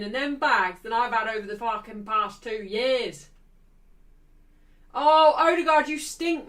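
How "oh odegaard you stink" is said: loud, higher fan jeer; loud scornful outburst, easing off. Real, not acted. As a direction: heckle the player from afar. Jeering a player on screen.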